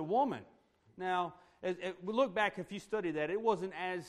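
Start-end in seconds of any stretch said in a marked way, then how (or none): 0.0-0.4 a short, louder, abrupt "Woman.". Retold or imitated. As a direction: snap out "Woman." curtly. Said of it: "rude or abrupt as it sounds".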